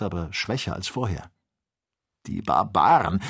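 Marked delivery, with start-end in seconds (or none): 2.3-3.2 agitated, louder outburst; excited. Acted. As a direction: blurt it in alarm. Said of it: "rief Joe in großer Aufregung". (aufgeregt ausgerufen)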